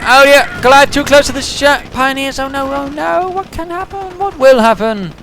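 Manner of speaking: high, loud alarmed commentary; rising panic at the unfolding attack; excited alarm. Real, not acted. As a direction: cry out as Goliath closes in. Caster panicking at Goliath approaching.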